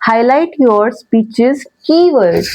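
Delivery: keywords lifted and drawn out, whole line very slow; stress on keywords. Shown as a demonstration. Teacher showing students how to highlight a keyword, slow and stressed. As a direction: lean on keywords and slow right down. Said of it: "अब मैंने keywords पे highlight किया".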